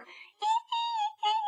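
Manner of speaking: very high, thin squeak; mouse squeak. Acted. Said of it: "запищал мышонок".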